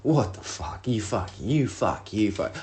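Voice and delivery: restrained retold cursing at oneself; retold losing it. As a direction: curse in a held-back, mock rage. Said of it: "I'm losing my shit".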